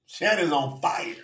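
ordinary talk, nothing marked

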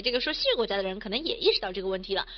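ordinary talk, nothing marked

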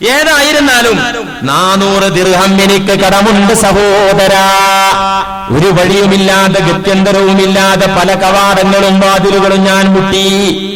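loud, ringing sermon voice; preaching at full force. Acted. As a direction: proclaim the story to the hall. Friend's plea, loud sermon. (ഉച്ചത്തിലുള്ള പ്രസംഗ ശൈലി)

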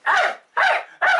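very high yapping arf, arf, arf; high puppet bark. Acted. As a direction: yap it like a tiny dog, way up high. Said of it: "bark for me".

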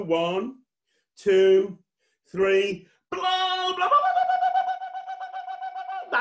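shouted count leaping into high babble; loud countdown. Pretend. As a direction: count up loudly and explode into a wild blow. Countdown, then blowing with children.

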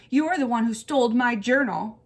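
put-on indignant kid in a quarrel; angry accusation. A narrator's reading. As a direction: throw the blame back hard. Acted quarrel between two girls.

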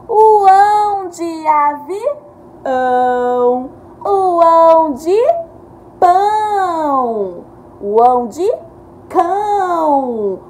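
ão sung out loud and high; stressed ending ão. Shown as a demonstration. Teacher highlighting rhyming endings for kids. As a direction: lean on the ão each time.

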